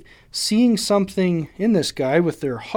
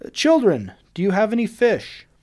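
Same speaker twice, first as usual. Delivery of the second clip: raised, higher call across the water; stranger calling from shore; 6 semitones higher than usual. Retold or imitated. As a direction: hail them from the beach, a bit higher. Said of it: "they just hear some guy yell".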